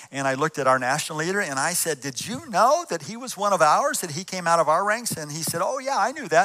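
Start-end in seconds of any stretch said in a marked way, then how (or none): 2.0-5.1 louder, higher pressing question; retold disbelief, raised voice; incredulous challenge. Confronting the national leader. 5.6-6.4 offhand leader. Retold or imitated. Leader's casual reply voiced.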